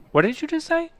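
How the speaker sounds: high-pitched, astonished question; acted disbelief. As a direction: ask as if you misheard, voice up high. Stunned that dad liked it.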